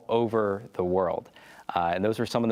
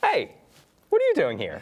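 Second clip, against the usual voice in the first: sudden high, loud call of surprise; surprised greeting; 9 semitones higher, 4 dB louder than usual. Real, not acted. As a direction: greet him like an unexpected friend. Surprise greeting of arriving guest.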